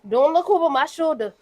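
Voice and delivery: teasing don't-hover-over-me complaint; playful protest. Real, not acted. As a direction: fend him off in jest. Shooing dad from watching her cook.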